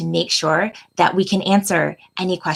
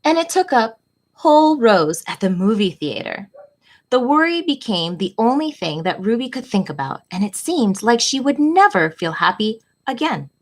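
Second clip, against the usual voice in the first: gentle, expressive picture-book read-aloud, often higher; reading to children; 4 semitones higher than usual. A narrator's reading. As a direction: read it like storytime for little kids. Reads picture book to class.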